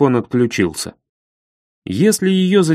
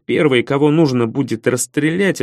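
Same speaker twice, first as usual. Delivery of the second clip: ordinary delivery, nothing marked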